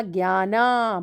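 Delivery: ज्ञाना with a lengthened, sustained ending; long आ. Shown as a demonstration. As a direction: stretch the final vowel of ज्ञाना into a long "aa". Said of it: "बड़ा आ बन गया".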